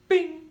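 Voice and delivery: ping it like metal hitting metal; sharp high bing noise; imitated clang. Gas nozzle clanging on tank.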